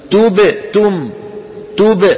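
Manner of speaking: تبت said with a break after the ب before تم; cut-off qalqala. Shown as a demonstration. Teacher imitates learners who chop the word. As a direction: pause after the bounce in تبتم. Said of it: "عم يقطع توب".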